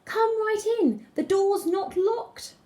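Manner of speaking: high, slow faked grandma voice; wolf pretending to be a sweet old granny; wolf's old lady voice. A narrator's reading. Wolf lures the girl inside, faking grandma's old voice. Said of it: "in his best old lady voice".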